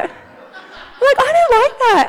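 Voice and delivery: high, laughing complaint; laughing protest. Real, not acted. Preacher laughs about hating her personality result. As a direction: laugh through the objection.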